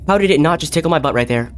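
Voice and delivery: ordinary talk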